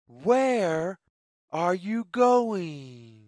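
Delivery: usual speaking voice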